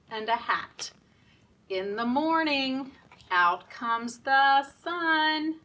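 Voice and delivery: rhythmic nursery rhyme chant, slow on the sun; sing-song rhyme. Acted. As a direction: chant the rhyme for little kids. Reading rhyme at story time.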